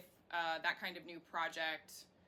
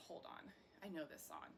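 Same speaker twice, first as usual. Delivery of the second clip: softly imitated bar-goer recognizing a tune; hushed, as if stopping mid-step to listen; mock surprised patron; 11 dB quieter than usual. Acted bar patrons recognizing song.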